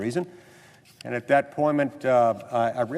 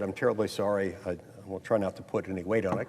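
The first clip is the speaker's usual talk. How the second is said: softer, quick sheepish apology; meek apology; 5 semitones lower, 6 dB quieter than usual. Retold or imitated. New senator to security guard, soft sheepish apology. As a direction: apologize softly and hurriedly.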